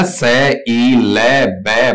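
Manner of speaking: से, ई, ले, बे as separate sounds; letter-by-letter phonetics. Shown as a demonstration. Teacher writing out phonetics for students, sounds said separately. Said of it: "इसका भी phonetic बना देता हूं".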